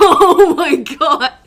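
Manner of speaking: loud, drawn-out cry through laughter; real helpless laughter, stretched words; laughing disbelief. Real, not acted. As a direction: exclaim while cracking up. Laughing at a played video.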